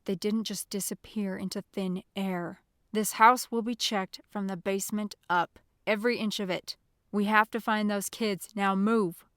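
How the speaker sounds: loud, forceful orders building to a bark; booming exasperation. A narrator's reading. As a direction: boom it out, fed up with subordinates. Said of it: "Agent Durant's voice boomed with exasperation".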